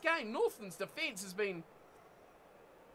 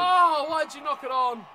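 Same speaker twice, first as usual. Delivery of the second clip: frustrated shout; 3 semitones higher, 9 dB louder than usual. Real, not acted. Gamer frustrated at knock-on.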